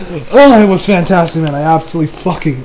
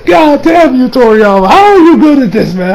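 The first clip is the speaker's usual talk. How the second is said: higher, louder playful scolding; excited mock scold; 5 semitones higher, 4 dB louder than usual. Real, not acted. Fan mock-scolding Toriyama, higher and louder. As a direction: shake a fist at the author, grinning.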